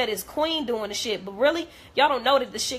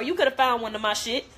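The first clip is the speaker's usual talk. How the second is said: ordinary delivery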